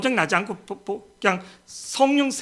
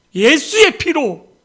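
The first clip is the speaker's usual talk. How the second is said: slow, loud, raised proclamation; emphatic proclamation; 6 semitones higher, 5 dB louder, much slower than usual. Real, not acted. Preacher answers how one is saved, loud and emphatic. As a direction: proclaim the answer in high, stretched words.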